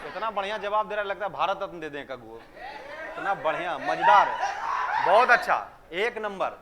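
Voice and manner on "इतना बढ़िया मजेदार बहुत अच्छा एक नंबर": drawn-out praise bursting into a loud cheer; gushing praise. Real, not acted. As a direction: build to a booming "बहुत अच्छा". Teacher hams up praise for answers. (जोश भरी, ज़ोरदार शाबाशी)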